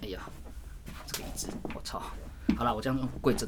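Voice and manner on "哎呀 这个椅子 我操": whispered swear under the breath; muttered curse. Real, not acted. Annoyed at the chair, swearing in a whisper.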